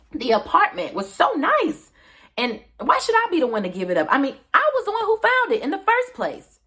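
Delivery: loud, high-pitched self-justification; acted indignant protest; defensive outburst. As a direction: argue it hotly, pitch soaring. Character defends herself for killing Frank.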